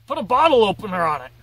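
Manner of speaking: fast, loud, tipsy blurt of "bottle"; tossing out a boozy idea; drunk guy. Retold or imitated. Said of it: "someone else who's drinking too much was like".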